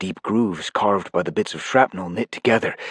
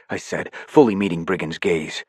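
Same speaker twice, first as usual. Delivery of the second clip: ordinary delivery, nothing marked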